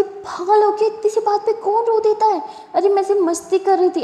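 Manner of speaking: shocked to find her friend in tears; surprised. Acted. Durga startled to find her crying.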